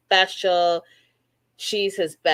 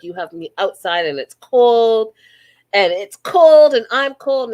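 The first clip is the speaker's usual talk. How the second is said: mock whiny complaint, louder at the end; complaining fiancée; 3 semitones higher, 9 dB louder than usual. Retelling the bride on the cold hilltop, mock whiny complaint. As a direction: imitate the bride whining she's cold. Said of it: "she's complaining it's cold".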